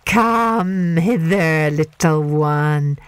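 very slow, sly crocodile voice. Acted. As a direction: draw the child in slowly.

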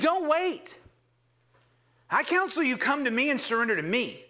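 normal talking voice

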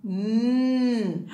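"Mm" hummed as a long nasal mm; the n sound. Shown as a demonstration. Teacher modelling the letter N sound "Mm" for the class. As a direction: hum "Mm" for the n sound, lips closed. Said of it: "N that makes a sound".

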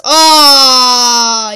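ordinary talk

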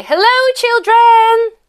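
put-on long held call to a group of children; called-out greeting. Shown as a demonstration. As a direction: sing out a greeting for a pupil to copy. Models greeting for pupil to call.

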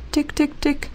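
quiet, high tik tik tik; soft tapping. A narrator's reading. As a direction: tap it softly on the glass. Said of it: "hoort Rosa getik".

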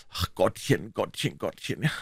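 mock sobs breaking up the words; sobbing. A narrator's reading. Huck laments his sins to Tom, sobbing. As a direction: whimper it as if crying. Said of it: "Auch Huckleberry schluchzte nun".

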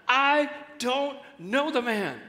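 high, slow, swearing denial; cursing denial. Retold or imitated. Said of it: "he began to call down curses, and he swore".

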